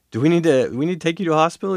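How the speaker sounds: acted urgent concern. Acting out his worried question.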